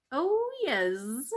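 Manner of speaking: slow, loud sing-song bees; drawn-out happy bee chorus; buzzing bees. Acted. Bees teasing the mouse, slow and loud.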